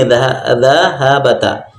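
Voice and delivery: dhahabata drawn out slowly, syllable by syllable; slow spelled-out reading. Shown as a demonstration. Teacher slowly sounding out dhahabata for students. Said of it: "Kata tulisannya begini".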